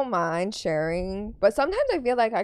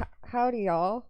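put-on Texas Southern accent; 3 semitones higher, 3 dB quieter than usual. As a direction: try out a Texas Southern howdy, laughing. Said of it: "give me your best Texas Southern accent".

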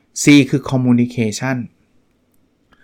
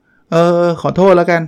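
insincere apology; 5 semitones higher, 6 dB louder than usual. Shown as a demonstration. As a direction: toss off "เออ ขอโทษแล้วกัน" just to end it. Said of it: "พูดแบบไม่ได้จริงใจอะไรเลยอะ".

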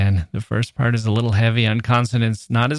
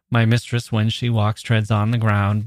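a little quicker. Shown as a demonstration. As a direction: run on through "mistress" and "walks" without pausing. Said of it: "We can read it a little quicker".

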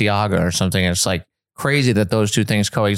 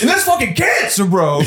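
high mock townie; 9 semitones higher than usual. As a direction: pitch it way up, hammed-up townie.

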